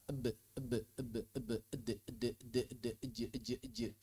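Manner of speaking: very soft, low repeated "Eb, eb"; quiet voiced bounce after each consonant of "eb"; voiced qalqalah release. Shown as a demonstration. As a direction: buzz a small schwa after b, d, j in "ed, ej". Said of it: "pita suara bergetar".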